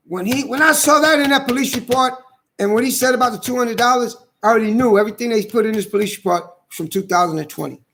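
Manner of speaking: worked-up rant about the report; heated rant. Real, not acted. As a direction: voice climbing high and fast in outrage. Rising anger over police report.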